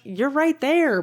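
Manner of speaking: a touch of playful outrage; mock exasperation. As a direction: point it out in exaggerated disbelief. Retelling her reaction to the film.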